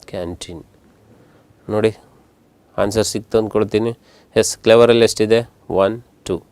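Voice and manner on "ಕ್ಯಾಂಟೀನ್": ಕ್ಯಾಂಟೀನ್ whispered softly. Shown as a demonstration. Teacher leaving students time to try, whispering the word. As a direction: say it under your breath.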